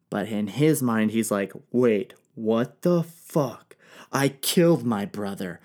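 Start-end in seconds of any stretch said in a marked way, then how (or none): 1.7-5.6 stunned disbelief. Acted. King shocked by news.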